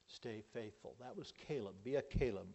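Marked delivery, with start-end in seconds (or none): 0.1-1.0 soft echo. Shown as a demonstration. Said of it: "Stay faithful, no matter what".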